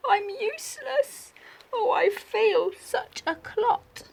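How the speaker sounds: sorry for himself. Acted. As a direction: wallow in misery like a sulky child. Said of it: "he thought".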